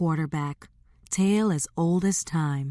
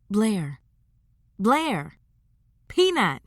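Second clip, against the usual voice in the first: calling for attention; 4 semitones higher, slower than usual. Acted. Sister calling a daydreaming Blair.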